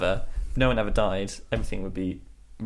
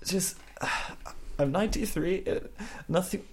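put-on high, thin old man's voice, sighing; a very old man wheezing out his complaints; feeble ninety-three-year-old; 7 semitones higher, 7 dB quieter than usual. To the carers, a frail old Alan Rickman complaining. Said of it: "Mister Rickman".

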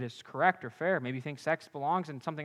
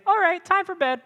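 much higher, louder sing-song mock of a casual brush-off; 14 semitones higher, 7 dB louder than usual. Preacher mocks a partner who ends the fun, high sing-song. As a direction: toss it off like it's nothing, in a silly high voice.

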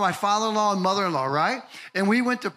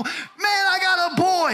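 proud shout; 9 semitones higher, 3 dB louder, slower than usual. Retold or imitated. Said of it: "I reflect back to the time standing on that table".

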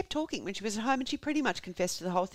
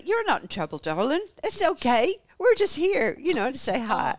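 higher, louder cooing to a suspect; mock reassurance; 7 semitones higher, 6 dB louder than usual. Investigators telling the wife she's fine, mock reassurance. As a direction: reassure her in a raised, put-on voice.

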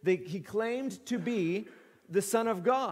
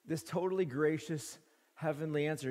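normal talking voice

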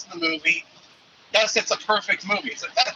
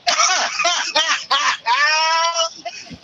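loud shouted laugh; 9 semitones higher, 4 dB louder than usual. Real, not acted. Caller cracks up at the idea.

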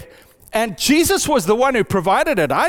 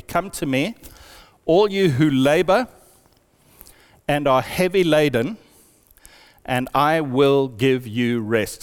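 measured scripture reading with long pauses; slow re-reading; 6 semitones lower than usual. Shown as a demonstration. Preacher re-reading the verse to the congregation, slowly and deliberately. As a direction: read it slowly, pausing after each phrase. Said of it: "read it again slowly".

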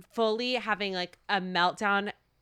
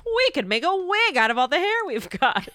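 high, loud mock amazement; hammed-up stylist; 9 semitones higher, 5 dB louder than usual. Stylist to a thick-haired client, hammed-up amazement. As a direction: imitate a stylist marvelling, pitched up and loud.

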